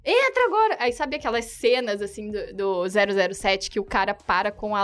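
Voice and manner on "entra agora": high, raised boatman's order; urgent command. Retold or imitated. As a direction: imitate someone hurrying people onto a boat. Boatman ordering them aboard.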